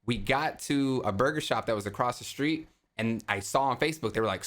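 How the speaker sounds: ordinary talk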